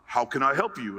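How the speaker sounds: lightly voiced guard, low and courteous; a hint of an obliging guard; polite security guard. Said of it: "Extremely polite".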